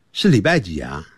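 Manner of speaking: ordinary talk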